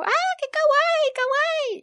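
very high, loud drawn-out squeal; high excited kawaii squeal; squealing fans. Retold or imitated. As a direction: coo it like a thrilled fan girl. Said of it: "Creo que era como".

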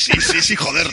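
high, loud burst of glee, laughing; imagining the cheer when Santa shows up, high and laughing; mock excitement. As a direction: cheer it like a fan in the cinema seat.

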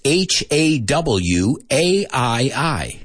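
slow, letter by letter; each letter drawn out and separate; spelling out. Shown as a demonstration. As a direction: spell it out slowly for students. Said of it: "I'm going to spell some words for you".

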